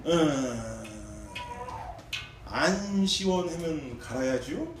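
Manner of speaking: unhurried, stretched country reply with 안 rising; slow Chungcheong drawl. Retold or imitated. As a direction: drag out the answer like a laconic mechanic. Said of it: "그게 너무 웃긴 거예요".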